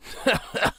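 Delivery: short breathy mocking laugh; brief scornful chuckle; guard's laugh. A narrator's reading. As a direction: scoff out a laugh at the boy. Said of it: "He gave the boy a shove and laughed".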